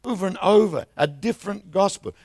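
normal talking voice

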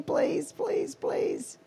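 high, half-whispered pleading; acted-out desperate begging; retold begging.

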